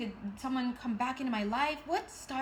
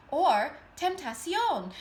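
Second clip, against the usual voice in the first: tentacion in a deliberately bad Spanish accent, a little higher and louder; 4 semitones higher, 5 dB louder, slower than usual. Shown as a demonstration. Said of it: "in the worst Spanish accent ever".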